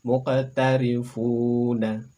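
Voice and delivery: Muqtarifuna very slow, q bouncing; slow Arabic demo. Shown as a demonstration. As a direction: spell out Muqtarifuna slowly. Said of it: "Berikutnya silakan".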